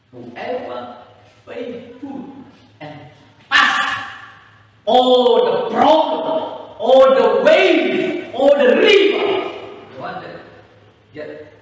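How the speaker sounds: usual speaking voice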